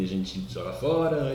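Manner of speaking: ordinary talk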